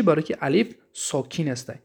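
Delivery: ordinary talk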